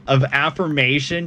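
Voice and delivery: normal talking voice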